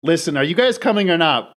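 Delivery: mild acted shout from a window; impatient shout. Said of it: "she shouts from out the window".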